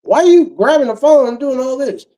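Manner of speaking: high-pitched mock protest; quoted woman. Girlfriend popping out over the phone, high mock indignation. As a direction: imitate her in a high, indignant voice. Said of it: "she wanna pop out the room".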